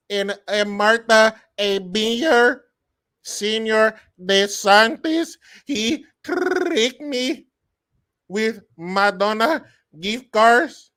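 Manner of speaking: loud, high, halting mock Spanish accent; hammed-up Spanish accent. Pretend migrant testifying at a microphone, hammed-up Spanish accent. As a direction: drag it out haltingly in a thick put-on Spanish accent.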